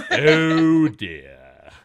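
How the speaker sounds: loud, low, drawn-out dismay; hammed-up deep "oh dear"; mock dismay. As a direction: boom it out low and slow. Echoes the mock weary reply.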